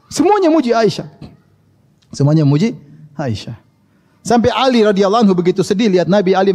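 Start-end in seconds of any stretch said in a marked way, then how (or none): 0.1-1.1 first take lifted much higher in pitch; high emphatic. Shown as a demonstration. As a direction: ring out "Semuanya muji Aisyah" high and bright. Repeated line, first raised high.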